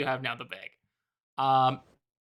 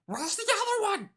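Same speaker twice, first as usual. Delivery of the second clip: high, quick goblin voice; faster than usual. Acted. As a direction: squeak it out like a goblin. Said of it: "very good goblin voice".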